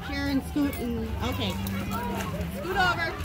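ordinary talk

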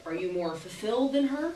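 usual speaking voice

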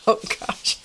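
ordinary talk

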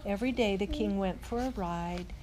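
plain reading voice

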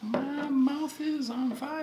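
high, slow squealing voice. Pretend. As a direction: squeal it out, mouth burning. Eating ghost pepper, mouth burning.

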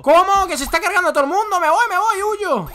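high, loud, fast fleeing yell; panicked shout. Real, not acted. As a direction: shout as you run away. Fleeing a rampaging giant. (grito de pánico rápido y agudo)

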